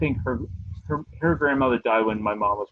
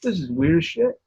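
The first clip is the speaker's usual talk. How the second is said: higher, a bit louder astonished quip; mock amazement; 7 semitones higher, 4 dB louder than usual. Son checking the empty upstairs, mildly spooked. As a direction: play it spooked but amused. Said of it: "I'm like".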